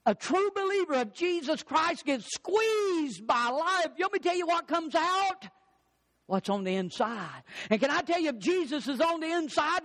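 high, fervent preaching. Acted. Pastor drives home the squeeze lesson, fervent. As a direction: build the sermon high and urgent.

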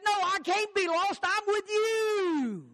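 put-on high, slow little boy's answer; the boy answers his grandpa high and slow. A boy answers his grandpa, high and slow.

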